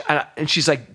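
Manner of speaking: normal talking voice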